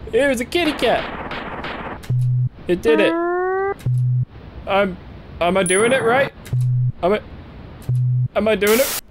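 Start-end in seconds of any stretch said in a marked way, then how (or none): none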